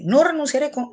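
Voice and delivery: no renunciaré said high and loud, with conviction; firm, decided. Shown as a demonstration. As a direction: declare it seriously, without doubt. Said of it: "Es diferente".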